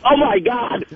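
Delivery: overacted gasp of shock; hammy startled reaction. Staged spit take, overreacting.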